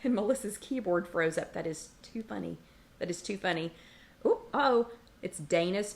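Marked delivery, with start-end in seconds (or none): none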